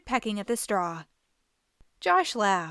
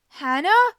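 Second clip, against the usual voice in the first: calling out; 8 semitones higher, 9 dB louder than usual. A narrator's reading. Joshua calls his sister.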